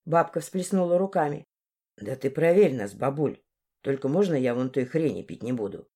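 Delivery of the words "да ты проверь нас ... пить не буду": low-pitched, insolent lad; cheeky young man. Acted. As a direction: drop the voice low and challenge the old woman. Narrator voices insolent Dmitry.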